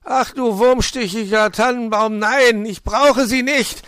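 high, full-force furious shout; angry screaming. A narrator's reading. Fox sending the magpie home, screaming angrily. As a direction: scream at her to leave you alone. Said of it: "schrie der Fuchs".

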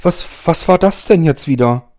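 high startled voice, frightened; terrified horror victim. Acted. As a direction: jump in fright and ask. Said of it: "Sandra schrie auf".

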